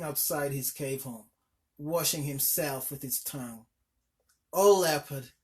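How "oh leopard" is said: louder weary call out; exhausted cry for help; exclaimed. A narrator's reading. Tired mother calling to the leopard for help. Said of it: "she exclaimed in a tired, tired voice".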